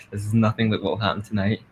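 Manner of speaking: ordinary talk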